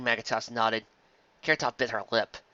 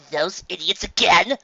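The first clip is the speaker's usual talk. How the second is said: slow, louder exasperated groan; 6 semitones higher, 6 dB louder, slower than usual. A narrator's reading. Trixie groaning to her friends at the con artists, exasperated. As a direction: groan it out, fed up. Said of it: "groaned Trixie".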